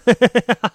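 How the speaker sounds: a quick bright burst of laughter; short laugh. Real, not acted. Amused at his own joke, short real laugh. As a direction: laugh at your own point.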